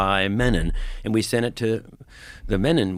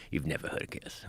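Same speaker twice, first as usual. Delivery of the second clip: very low, hushed impression; quiet mock disbelief; 6 semitones lower, 14 dB quieter than usual. Offended rock star questions the guest, low hushed imitation. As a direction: drop low and almost voiceless, imitating an affronted star. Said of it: "he looks at me like".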